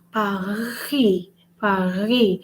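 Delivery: Paris with a long rasped R and silent S; Paris, French-style, leaning on the R; stressed French R. Shown as a demonstration. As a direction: draw out the throaty R in Paris. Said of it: "they will stress on the R".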